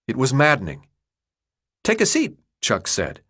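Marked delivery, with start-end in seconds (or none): 1.8-2.4 put-on high young boy, offhand; much higher kid voice, casual. Chuck offering food.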